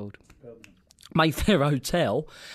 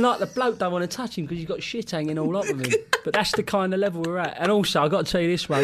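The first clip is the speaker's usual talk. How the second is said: ordinary delivery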